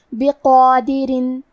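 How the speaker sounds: بقادرین said slowly with a heavy, full qaf and a long a; drawn-out بقادرین with a thick emphatic qaf; slow model reading of بقادرین, heavy qaf; tajweed demo. Shown as a demonstration. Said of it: "حرف القاف کو موٹی آواز میں پڑھیں".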